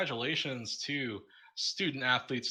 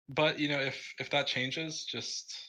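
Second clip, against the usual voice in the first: words stretched by a yawn; talking mid-yawn, slow and drawn; 5 semitones higher, slower than usual. Real, not acted. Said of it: "Sorry, yawning".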